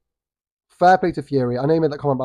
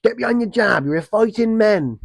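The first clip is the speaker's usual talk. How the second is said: much higher, strained imitation of a yelling dad; mock screaming dad; 8 semitones higher than usual. Vlogger mocking Fury's father yelling from the corner, high mock scream. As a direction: squeal it like an overbearing corner dad. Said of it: "you got your dad screaming".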